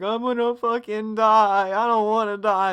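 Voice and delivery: mock crying. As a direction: whine it out like a stoned kid crying. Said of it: "like, crying, like".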